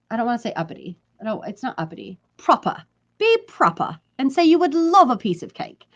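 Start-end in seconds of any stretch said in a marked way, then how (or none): 2.4-5.9 put-on proper British accent, higher pitch. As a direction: say it in a prim mock-British accent. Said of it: "why that needs to be British".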